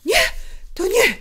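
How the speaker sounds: high, loud, angry cry; an enraged woman calling out; trembling with anger. A narrator's reading. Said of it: "zawołała głosem drżącym z gniewu".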